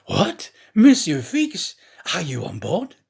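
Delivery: put-on genuinely taken aback at seeing an old shipmate; startled French servant; astonished. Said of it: "the really astonished Passepartout".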